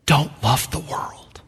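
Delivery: put-on hushed, slow plain warning, not a Welsh accent; quiet retold preacher quote. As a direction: let the warning drop soft and slow. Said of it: "he stopped in that Welsh accent".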